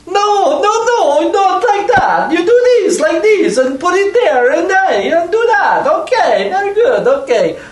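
high, bustling merchant coaching someone; chirpy shopkeeper fussing over a task; fussy shopkeeper voice. Acted.

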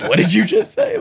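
loud, very high incredulous question; hammed-up imitation of a stunned friend; mock disbelief.

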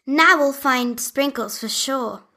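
Barbie Leah calling for Sprinkles. Acted. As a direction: call out for the dog, anxious. Leah character voice.

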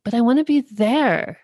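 a hint of a stubborn child pleading; Elmo voice. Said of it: "And Elmo's like".

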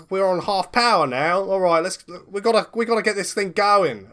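high, loud Australian boss urging; Australian villain. Acted. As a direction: push the crew on in an Aussie voice.

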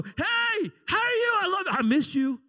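high, loud, gushing hello; squealing high good-morning; bubbly morning greeting. Retold or imitated. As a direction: overeager morning person bursting with love. Morning person greeting spouse.

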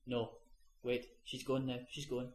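very soft, sinking nearly to nothing; hushed killer quote. Retold or imitated. Killer hushing the crew as the old woman dies, hushed. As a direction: let it fade away as if watching someone slip away.

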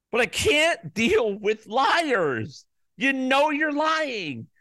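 slow, high-pitched exasperation; exasperated accusation. Real, not acted. Host tells sore losers he can't deal with liars, exasperated accusation. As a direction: stretch every word at the liars, pitched high.

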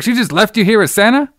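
mock scolding mom. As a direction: lightly play the outraged store manager.

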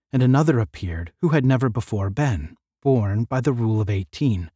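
plain reading voice

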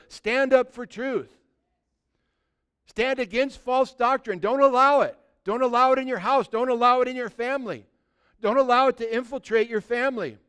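forceful pulpit exhortation; preaching at full force. Acted. Pastor warns the church against false doctrine, forceful. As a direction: drive it home to the congregation.

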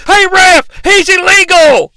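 high, loud protest at the referee; mock Jim Ross protest yell; imitated yell. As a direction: shout the complaint like an outraged commentator. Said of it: "yells J R".

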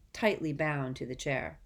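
usual speaking voice

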